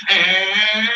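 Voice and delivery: mock siren, a single drawn-out ah; imitated siren wail. Comedian imitates the Colorado air raid siren, a loud wail. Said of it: "It just goes".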